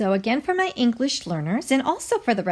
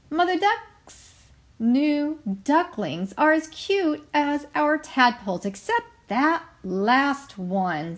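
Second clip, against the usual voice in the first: higher, slow, gossipy frog voice; sniffy Mrs Frog; 6 semitones higher, slower than usual. Acted. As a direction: sneer at the last duckling from up high. Mrs Frog mocks the duckling.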